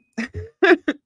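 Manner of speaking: a sudden high squeal of laughter. Real, not acted. Reacting to a bug in her nose, high squealing laugh.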